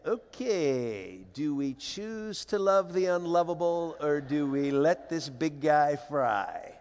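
slow, drawn-out weighing of options; deliberating. Retold or imitated. Kids deciding whether to rescue dad, acted slow deliberation. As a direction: ponder it with mock gravity, stretching each word.

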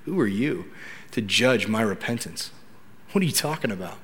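softly voiced mock indignation; retold indignation. Young man challenging the pastor's judgment, quietly indignant. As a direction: act out a hushed, affronted challenge. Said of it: "immediately indignant and frustrated".

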